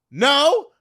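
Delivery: loud, high, hammed-up frantic excuse; flustered cover story. Woman fibbing to caller while running through streets, flustered. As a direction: bluff loudly while on the run. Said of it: "and I'm out of breath".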